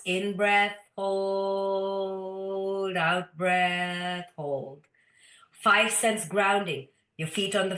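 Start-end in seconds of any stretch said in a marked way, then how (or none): 0.0-4.9 slow breathing cues. Shown as a demonstration. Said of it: "Like you create a box".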